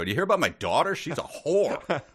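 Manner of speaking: loud, high mock father shouting; hammed-up angry father. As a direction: play it as a scandalized dad. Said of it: "was just going up to the metalworking place and go".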